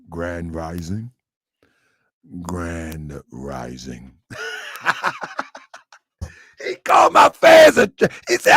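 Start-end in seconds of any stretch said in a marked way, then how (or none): 0.1-4.1 low, slow, soft drawn-out greeting; mock Grand Rising greeting, very low. Mocking rival's greeting. 4.3-5.4 airy high laugh; breathy squeal of a laugh; high breathy giggle. Real, not acted.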